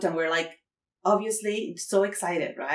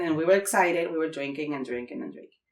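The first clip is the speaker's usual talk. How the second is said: normal talking voice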